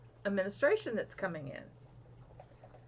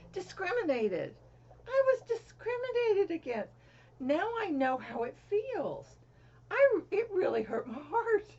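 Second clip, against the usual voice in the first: indignant hurt; 9 semitones higher, 4 dB louder than usual. Real, not acted. Hurt by an unsubscribe comment.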